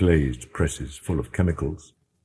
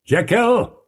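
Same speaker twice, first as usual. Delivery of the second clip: a high, loud call of a name through a door; loud cry; 4 dB louder than usual. Acted. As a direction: call Jekyll out, loud and commanding. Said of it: "cried Utterson with a loud voice".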